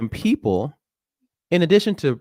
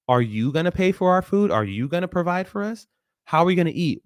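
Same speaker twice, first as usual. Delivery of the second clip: higher-pitched, pointed questions; challenging retort; 7 semitones higher than usual. Retold or imitated. South Africans answering Julius's boycott call, challenging. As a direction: put the challenge back on him. Acted public backlash at Julius.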